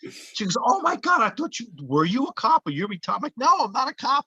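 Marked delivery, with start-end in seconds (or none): 0.6-3.2 mock astonishment. Actress realising he is not police, astonished. 3.4-4.2 higher, insistent retold denial; emphatic denial. Actor to actress who mistook him, emphatic denial.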